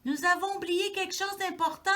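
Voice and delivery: high, loud urgent call; high, loud little mouse voice. A narrator's reading. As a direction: shout it after him. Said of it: "appelle-t-il".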